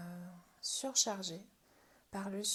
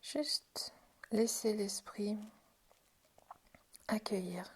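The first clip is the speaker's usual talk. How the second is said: guided meditation. Acted. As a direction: guide them inward gently, half-whispered questions. Guides a hands-on relaxation. (voix de méditation guidée, douce et chuchotée)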